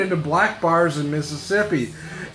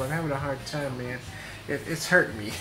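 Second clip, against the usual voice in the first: ordinary delivery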